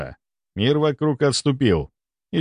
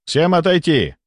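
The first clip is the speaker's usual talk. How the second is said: raised-pitch, firm command; commanding order; 6 semitones higher than usual. A narrator's reading. The narrator orders everyone away from the patient, commanding. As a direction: order the crowd back. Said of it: "приказал я".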